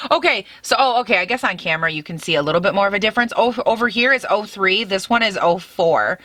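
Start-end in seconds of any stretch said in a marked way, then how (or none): none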